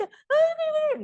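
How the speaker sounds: very high-pitched mock whimper; squeaky put-on wailing; silly whining, mocked. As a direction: whine like a pampered kid, exaggerated. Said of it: "Pero yo esa bobada de que".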